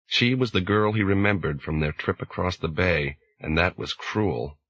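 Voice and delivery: ordinary delivery, nothing marked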